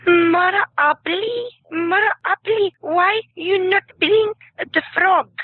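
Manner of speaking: put-on high, slow child's voice with an Israeli-Mexican accent; little girl's accent. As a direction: imitate a small girl with an Israeli-Mexican accent. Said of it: "this girl goes; strange but very pretty accent".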